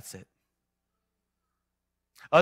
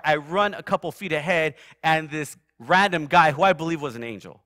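ordinary talk

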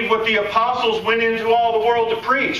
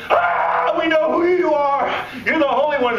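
screaming demons; 6 semitones higher than usual. Retold or imitated. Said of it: "they started screaming".

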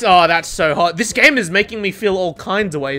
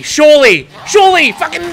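excited shout; 6 semitones higher, 7 dB louder than usual. Real, not acted. Hyped calling out for a hit.

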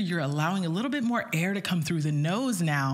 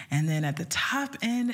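normal talking voice

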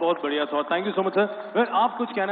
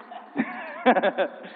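amused laugh after a joke. Real, not acted. Host laughs after teasing the guest on stage, amused. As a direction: let out a laugh at your own punchline.

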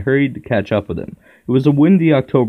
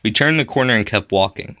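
plain reading voice

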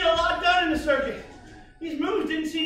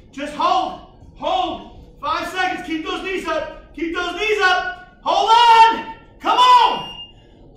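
barking gym coach; 3 semitones higher, 8 dB louder, much slower than usual. Real, not acted. Coach barking during bear crawls.

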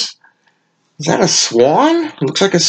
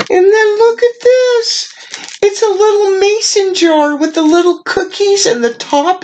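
giddy delight; 14 semitones higher than usual. Real, not acted. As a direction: squeal over the little jar. Showing a mason jar gift.

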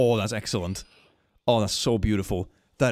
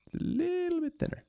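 squeaky voice; 5 dB quieter than usual. Pretend. Playful voice on thinner tail.

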